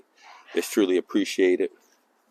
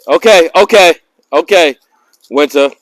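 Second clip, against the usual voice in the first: loud settling call; 9 semitones higher, 14 dB louder than usual. Real, not acted. Calming dog loudly.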